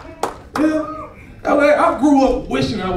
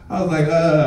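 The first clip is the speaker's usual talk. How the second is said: ordinary talk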